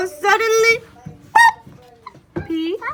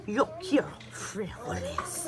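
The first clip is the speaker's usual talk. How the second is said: somber declaration; 8 dB quieter than usual. A narrator's reading. Don Diego stands tall to declare his wish, somber. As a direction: declare it gravely, like a dark omen. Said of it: "in his most somber voice he declared".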